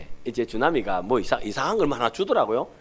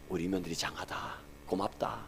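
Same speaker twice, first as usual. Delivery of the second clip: soft, low, tender words; tender praise; 7 semitones lower, 15 dB quieter than usual. Retold or imitated. Father-in-law hugging his daughter-in-law, soft tender praise. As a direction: hold her close and praise her gently.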